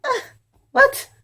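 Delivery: startled high yelp of surprise; shocked what. Retold or imitated. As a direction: jump way up in pitch in sudden disbelief. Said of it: "I'm like".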